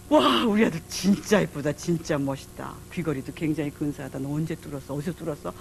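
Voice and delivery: re-enacted motherly wonder; tender admiration. Mother admiring her son, retold.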